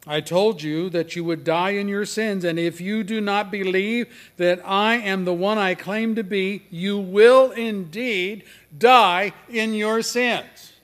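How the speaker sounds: loud, high preaching voice; forceful scripture reading. A narrator's reading. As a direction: thunder the verse out, building to the end. Reads scripture at full force.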